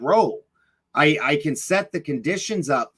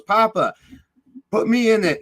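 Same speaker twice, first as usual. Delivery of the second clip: higher, lightly voiced little boy asking; a small child begging Papa, pitched up; grandson's plea; 8 semitones higher, 3 dB louder than usual. Grandson Kai asks Papa to lift him in, lightly voiced child. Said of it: "turns and looks at me and goes".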